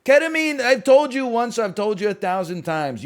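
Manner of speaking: high, loud mock scolding. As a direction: scold the chatter like a fed-up parent. Scolding a chatter about ketamine.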